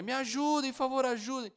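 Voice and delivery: high, pleading cry for help; calling for help. Retold or imitated. Man poisoned by gas, begging neighbours for help desperately. Crawling to door, gas leak.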